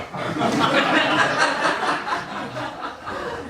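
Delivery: high breathless laugh at his own joke; real laughter. Real, not acted. Poet cracking up at his own line before the crowd, laughing. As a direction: break up laughing mid-poem.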